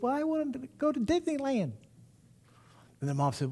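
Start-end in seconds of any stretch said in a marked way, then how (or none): 0.0-1.7 put-on much higher, small and soft little-girl voice; the girl in the back seat answering mom, childlike and high. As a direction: pitch up to play a shy little girl. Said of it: "She goes".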